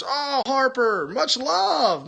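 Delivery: high, slow, sing-song "much love"; gushing thanks. Real, not acted. Thanking Harper for the super hearts, high and gushing.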